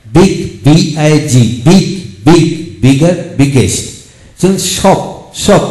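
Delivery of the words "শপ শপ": "শপ" with a firmly sounded closing p; stressed final P. Shown as a demonstration. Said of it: "পি এর উচ্চারণ বেশি হয়".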